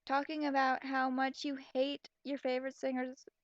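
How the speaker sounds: usual speaking voice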